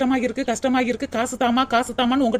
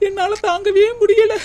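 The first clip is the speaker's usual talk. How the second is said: mock crying; 6 semitones higher, 3 dB louder than usual. Daughter-in-law complains to viewers.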